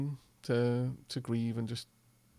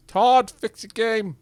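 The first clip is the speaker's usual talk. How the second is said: exasperated; 5 semitones higher, 8 dB louder, slower than usual. Real, not acted. Exasperated at game bug.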